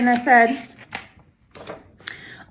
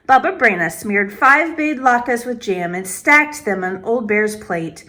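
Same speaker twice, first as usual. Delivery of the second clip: plain reading voice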